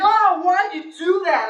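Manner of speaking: high, slow, whiny lady; offended old lady. Retold or imitated. Salted ATM lady protesting, whiny. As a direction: stretch it out hurt. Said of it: "She's like".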